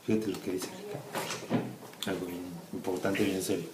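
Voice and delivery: whispered aside, soft and slow; hushed. Real, not acted. Parent hushes to Kika about something serious, whispering. Drops voice to stress importance.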